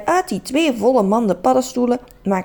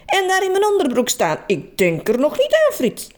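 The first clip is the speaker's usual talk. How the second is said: shouting; 8 semitones higher than usual. Acted. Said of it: "Trudy schreeuwde vanuit de badkamer".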